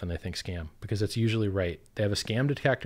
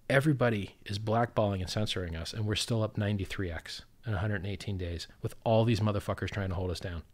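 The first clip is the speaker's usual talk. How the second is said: normal talking voice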